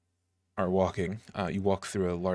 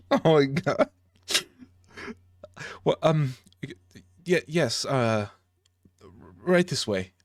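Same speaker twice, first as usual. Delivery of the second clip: higher, louder stammering little dwarf; flustered dwarf voice; 6 semitones higher, 4 dB louder than usual. Acted. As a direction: blurt it out in a fluster. Flustered dwarf reacts to name.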